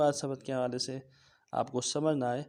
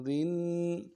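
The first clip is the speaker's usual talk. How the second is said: usual speaking voice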